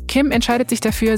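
ordinary talk

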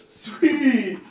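high, loud, laughing mock enthusiasm; mock excited. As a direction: hype it up sarcastically. Mock excitement about Ashtabula.

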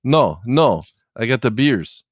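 loud imitated Russian accent. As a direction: raise the voice in a mock Russian accent. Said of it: "he had that Russian accent".

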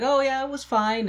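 high, offhand mock reply; Farley shrugging off the hockey game, high. Farley answering Eve.

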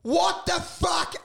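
high, slow comic outburst; exasperated disbelief. Retold or imitated. Comic ranting about stale gala acts, exasperated disbelief. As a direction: drag it out in baffled indignation.

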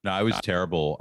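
ordinary talk, nothing marked

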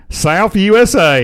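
high, slow Southern drawl; Southern US accent. Acted. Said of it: "Southern accent is a good one".

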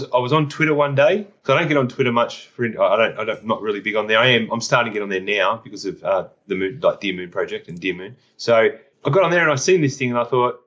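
ordinary talk, nothing marked

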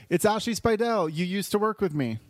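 high, peppy self-introduction; mock perky introduction. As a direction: introduce yourself with exaggerated cheer. Acting out her introducing herself.

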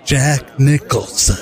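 slow drawn-out Nicholson voice; Jack Nicholson callback. Pretend. Answering the knock-knock joke in slow Nicholson voice. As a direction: stretch the name like Nicholson. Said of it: "Oh, callback".

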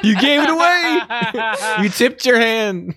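high-pitched, through laughter; real amused teasing. Real, not acted. Co-host teasing friend who gave it away by crying, laughing. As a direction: crow it while cracking up.

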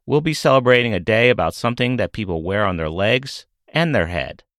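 ordinary delivery

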